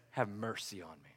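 very soft, low prayer; hushed plea. Retold or imitated. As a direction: drop right down and plead quietly. Voicing the beggar's plea for mercy.

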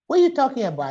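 husband protesting his own laundry duty, indignant. Retold or imitated. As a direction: bristle at the idea of doing laundry. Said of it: "He said".

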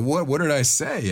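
high-pitched retold protest; imitating a flustered plea; mock bewilderment. Retells bewildered plea to guest.

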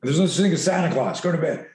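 hammed-up impatient dad; mock dismissive parent. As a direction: shut the kid's fear down.

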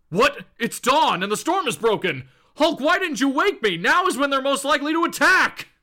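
startled, urgent commanding voice; rising in pitch to an alarmed scolding; Captain America. Acted. Captain waking to scold his lookout, hammed-up alarm. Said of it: "Captain America bolts awake and goes".

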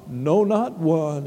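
low, slow echo; subdued refrain. Real, not acted. Preacher repeating the refrain to himself and church, low and subdued. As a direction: drop low and say it slowly.

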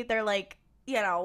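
normal talking voice

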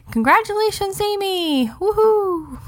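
high, singsong congratulations; celebratory cheer. Real, not acted. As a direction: cheer for the winner in a high-pitched voice. Cheering the t-shirt winner.

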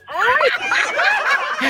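high-pitched shriek through laughter; excited squealing. Real, not acted. Host and caller giddy over a [name] fantasy, excited squealing.

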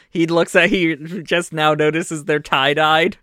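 ordinary talk, nothing marked

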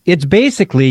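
normal talking voice

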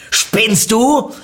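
loud, high-pitched outraged yell; angry shouting. A narrator's reading. Car owner finds narrator on his car, angry shout. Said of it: "schrie mich der Besitzer des Autos an".

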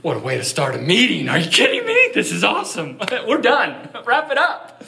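high, loud laughing disbelief; giddy celebration at the cancer news; excited laughing. Retold or imitated. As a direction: burst out in joyful laughter. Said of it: "We're like".

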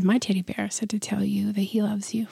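soft unvoiced whisper. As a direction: whisper it like a shy child. Child's teddy bear message.

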